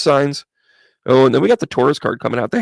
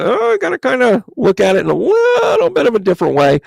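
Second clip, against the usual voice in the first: mock realization; 9 semitones higher than usual. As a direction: voice the client suddenly seeing it differently. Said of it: "this is kind of like".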